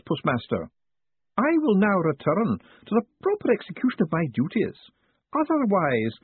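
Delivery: put-on high, clipped stern old clerk; prim postmistress. A narrator's reading. Stern clerk talking down to Moist, in a prim high voice. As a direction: speak like a stern counter clerk.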